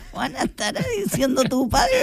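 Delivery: talking through real laughter; laughing. Real, not acted. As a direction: laugh while you tease. Laughing while teasing Pedro.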